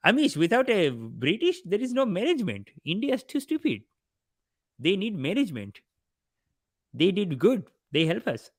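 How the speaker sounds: mock Indian uncle voice praising the British; imitating brother-in-law. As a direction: parody the brother-in-law's thick Indian accent. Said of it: "he's like".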